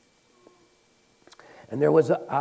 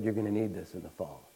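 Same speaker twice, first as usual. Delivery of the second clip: hushed, gentle; 5 semitones lower, 7 dB quieter, faster than usual. Retold or imitated. Gentle handover to the friend.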